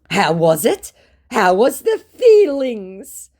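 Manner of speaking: drawn-out curious question; eager scammer. Retold or imitated. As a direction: scammer asking about his show, high and slow. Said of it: "he asked".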